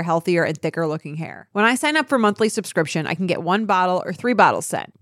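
smooth sponsor read, even and polished. Acted. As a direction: read the ad like a host spot. Reads a sponsor ad.

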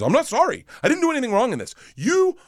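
high-pitched mock indignation; indignant wife. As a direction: imitate an outraged wife refusing to apologize. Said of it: "And she goes".

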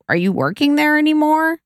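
high, pointed mock question; throwing slight shade. Mom asking daughter about her job, slight shade. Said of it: "a little shade".

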